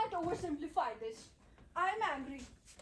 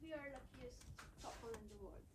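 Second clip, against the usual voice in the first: much softer, rueful murmurs; quiet sad resignation; 7 semitones lower, 12 dB quieter than usual. Acted. Couple after the quarrel, quiet and rueful. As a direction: let the fight drain out of you.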